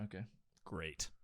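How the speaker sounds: usual speaking voice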